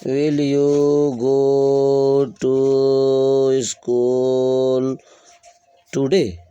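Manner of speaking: every word stretched long, held flat; very slow dictation. Shown as a demonstration. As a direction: draw each word out for students to copy. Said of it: "drawn-out dictation".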